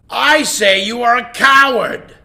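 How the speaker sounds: loud high roar; king bellowing insults into the well; roaring lion. Acted. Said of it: "roared the lion".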